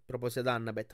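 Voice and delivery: plain reading voice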